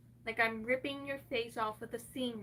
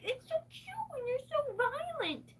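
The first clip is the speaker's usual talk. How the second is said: much higher sing-song mock voice; mimicking a patronizing coo; mocking high quote; 13 semitones higher than usual. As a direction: squeak it like the people she mocks. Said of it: "being like".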